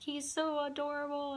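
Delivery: put-on high cooing over the baby; doting grandma gush. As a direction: coo like a smitten grandmother. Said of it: "She is just like".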